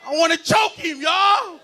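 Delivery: high, loud comic frustration; mock outrage. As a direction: shout it like an exasperated parent. Mock outrage at pinching son.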